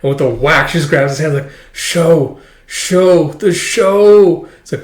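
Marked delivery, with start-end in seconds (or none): 1.7-3.3 higher, louder slurred repeats; mock mumbling. Dragging him, mumbling. 3.3-4.5 much higher, louder slurred whine; slurred insistence. Retold or imitated. Dragging him, mumbling.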